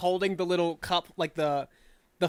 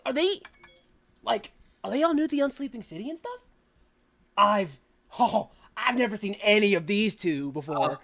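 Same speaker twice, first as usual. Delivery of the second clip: surprised excitement; 3 semitones higher than usual. Acted. GM voices excited surprised twin.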